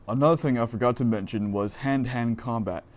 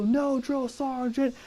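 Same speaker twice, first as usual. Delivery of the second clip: high, slow, small recruit voice; meek recruit reply; 12 semitones higher, much slower than usual. Retold or imitated. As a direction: answer like a cowed private. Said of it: "I go".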